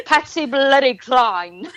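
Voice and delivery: a Southern woman teasing a British guest; imitating British. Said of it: "You said".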